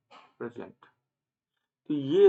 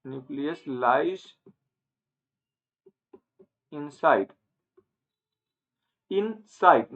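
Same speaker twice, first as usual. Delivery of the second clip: very slow, word-by-word dictation; much slower than usual. Real, not acted. Teacher giving students the plant cell answer, slow dictation. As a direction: spell out the key phrase slowly for students.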